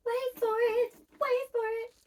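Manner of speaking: high, excited teasing build-up; speeding up to reveal. Shown as a demonstration. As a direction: squeal the suspense before the reveal.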